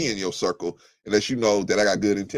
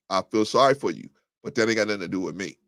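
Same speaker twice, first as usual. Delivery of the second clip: normal talking voice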